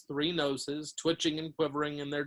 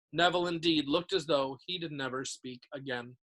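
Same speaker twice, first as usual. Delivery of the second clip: ordinary delivery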